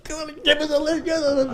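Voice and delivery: high, soft mock pleading; imitating himself begging the convoy; pleading for a lift. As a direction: beg the trucks to stop, voice up high.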